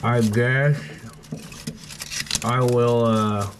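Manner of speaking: talking around a flashlight held in the teeth; muffled words, mouth held open. Real, not acted. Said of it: "sorry, I have a flashlight in my mouth".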